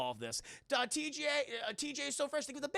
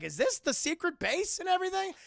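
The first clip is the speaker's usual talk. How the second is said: ordinary talk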